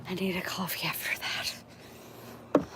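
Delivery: slow, breathy whisper after a draining call. Real, not acted. Drained after a long phone call, whispering. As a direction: sigh it out quietly, spent.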